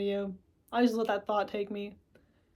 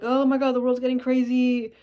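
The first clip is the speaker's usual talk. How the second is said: mock panic; 4 semitones higher, 8 dB louder than usual. Acting out panicked self-talk.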